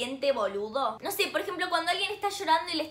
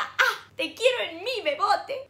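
higher, cooing "bebote"; mock tender; 5 semitones higher than usual. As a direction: coo it like to a lover. Loving the piercing. (tono meloso en broma)

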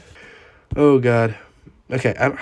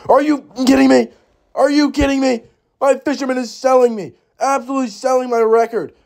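much higher, louder exasperated rant; frustrated outburst; 11 semitones higher, 3 dB louder than usual. Real, not acted. Gamer blaming his Fisherman for losing, high exasperated outburst. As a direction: rant at it in disbelief. Furious at his own troop.

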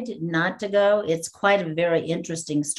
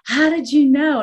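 high, loud retold astonishment; amazed question, acting out the moment; astonished; 9 semitones higher, 7 dB louder than usual. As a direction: blurt it out in mock amazement, pitched up. Retold astonishment at being recognised.

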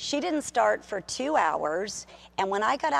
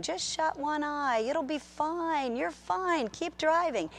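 sweet, lulling temptation voice; lying inner voice; 8 semitones higher, slower than usual. Retold or imitated. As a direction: coax like a sly voice in your head. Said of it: "that voice that kept saying".

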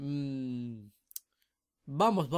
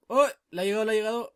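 higher and louder, thrilled call; excited; 8 semitones higher, 9 dB louder, faster than usual. Real, not acted. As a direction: cheer the ult landing. Ultimate lands, excited.

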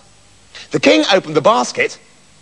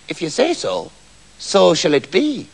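mysterious little man; 3 semitones lower, 3 dB quieter than usual. A narrator's reading. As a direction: say it softly, like a quiet curse. Little man's repeated spell.

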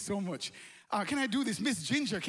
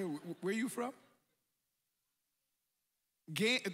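normal talking voice

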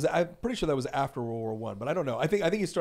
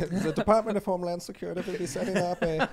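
put-on German accent; 6 semitones higher, 6 dB louder than usual. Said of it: "I'm hearing it in a German accent".

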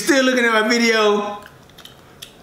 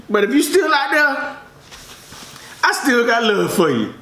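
normal talking voice

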